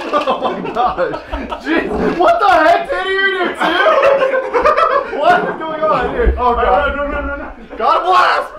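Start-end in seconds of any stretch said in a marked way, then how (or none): none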